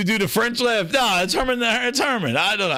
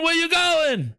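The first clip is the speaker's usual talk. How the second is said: ordinary talk, nothing marked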